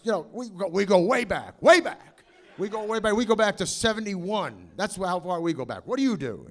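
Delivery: loud, high, manic boasting; loud eccentric actor bragging; Lloyd impression. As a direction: brag wildly like Christopher Lloyd. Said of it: "he says".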